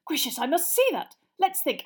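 high, loud, eager wonder; excited curiosity. Acted. Mister Weasley fascinated by a plug, excited. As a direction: marvel like a delighted enthusiast. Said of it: "said Mister Weasley's voice excitedly".

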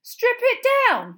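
high, slow, loud incredulous repeat; as if she'd gone mad. A narrator's reading. Ron repeats McGonagall in disbelief, outraged. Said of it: "as though Professor McGonagall was mad".

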